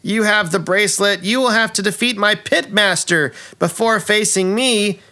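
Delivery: high, hammy villain taunt; gloating villainess Neshortas. Acted. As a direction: crow over the heroes theatrically. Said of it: "And Neshortas says".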